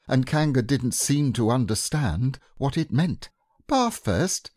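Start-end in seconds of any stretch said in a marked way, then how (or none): 3.7-4.5 higher, bright-spirited mother voice; cheerful mother announcing bath. Acted. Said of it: "said Kanga in a cheerful voice".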